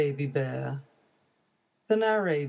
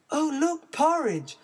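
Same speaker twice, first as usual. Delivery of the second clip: delighted; 11 semitones higher than usual. Acted. Goldilocks delighted.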